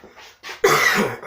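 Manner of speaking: loud rough coughing. Real, not acted. Coughing from heartburn.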